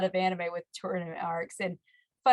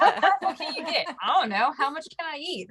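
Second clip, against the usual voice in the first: laughing mock quote; 8 semitones higher, 3 dB louder, faster than usual. Laughing mock of anime hero.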